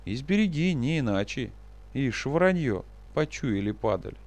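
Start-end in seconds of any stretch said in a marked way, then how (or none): none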